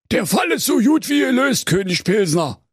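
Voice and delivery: high-pitched Berlin detective; high, self-assured cop voice; triumphant commissioner. A narrator's reading. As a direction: declare it with confident flourish. Commissioner declares case solved.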